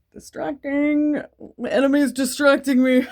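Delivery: mock dramatic. Real, not acted. Joking aside about a zit.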